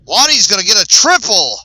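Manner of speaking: loud, high cry of amazement; stunned sportscaster shouting in disbelief; excited disbelief. Real, not acted. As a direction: call it out in real astonishment, high and loud. Disbelieving call of a surprise triple.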